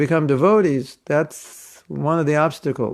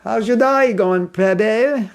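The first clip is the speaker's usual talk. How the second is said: higher-pitched Australian greeting, "day" said as "die"; Australian accent; 7 semitones higher, slower than usual. Shown as a demonstration. Showing devotees how Australians greet, Australian accent. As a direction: put on a high Australian accent. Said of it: "Australians pronounce day as die".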